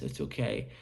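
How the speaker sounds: low, soft, deflated "It's okay"; sad, defeated agent. Shown as a demonstration. Agent on a sales call to a client, sounding sad and defeated. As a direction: sound like you've given up on the call. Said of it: "and I just sounded sad".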